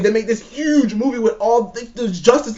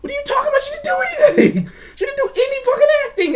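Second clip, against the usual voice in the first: incredulous retort; faster than usual. Retold or imitated. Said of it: "I'm like".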